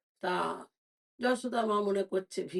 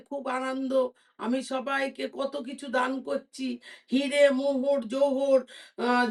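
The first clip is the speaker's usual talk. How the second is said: lightly voiced joyful queen, a little higher; Queen Yashoda; 5 semitones higher, 5 dB louder than usual. Queen tells her maid to pay the beggar, lightly raised joyful voice.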